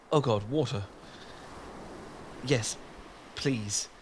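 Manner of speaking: ordinary talk, nothing marked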